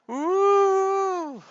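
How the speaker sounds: high, loud hooting ghost moan; spooky high ghost woo. Pretend. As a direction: howl it like a cartoon ghost. Ghost getting closer and louder.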